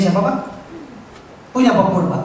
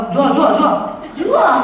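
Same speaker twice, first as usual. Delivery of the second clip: mock eager sell; 6 semitones higher, 4 dB louder, slower than usual. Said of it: "Kalau kata Pak Purba".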